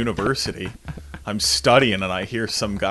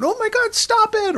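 very high, shrill alarmed cry; shocked bystander; 5 dB louder than usual. Pretend. Normal neighbors watching a fight, high alarmed cry. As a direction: squeal it in panic.

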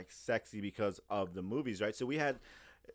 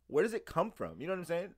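usual speaking voice

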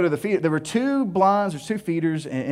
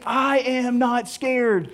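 each word slow and separate, I am not scared; slow full words; 5 semitones higher, much slower than usual. Shown as a demonstration. Explaining the sticker's full meaning, slow and deliberate. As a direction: spell out all four words slowly. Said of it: "rolled it into about three syllables".

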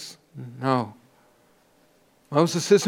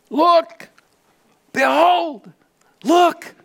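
urgent elder's call; 13 semitones higher, 3 dB louder, much slower than usual. Acted. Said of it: "one of the elders said to me".

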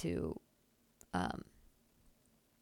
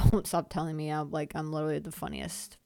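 ordinary talk, nothing marked

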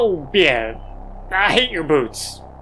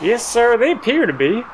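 chipper, higher helper voice; a polite, eager robot sidekick; high robot butler voice; 6 semitones higher than usual. Acted.

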